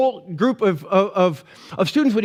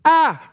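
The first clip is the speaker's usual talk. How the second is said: high startled yelp; mock scream; 8 semitones higher, 4 dB louder than usual. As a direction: let out a short pretend shriek, pitched way up. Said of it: "Moses goes".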